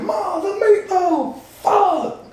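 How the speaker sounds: high, slow, drawn-out mock yell; repeat meatloaf yell. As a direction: stretch it out in frustration. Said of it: "about a minute later".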